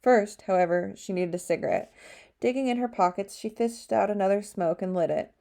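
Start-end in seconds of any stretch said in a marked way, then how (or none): none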